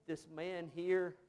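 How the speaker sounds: normal talking voice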